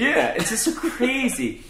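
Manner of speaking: laughing while talking. Real, not acted. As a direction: laugh through the words.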